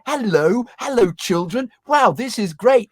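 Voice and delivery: put-on high, slow over-bright kids' TV voice; mock the replacement's fake enthusiasm; gushing presenter. Mocking the presenter who replaced him, high and gushing. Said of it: "replaced by somebody who".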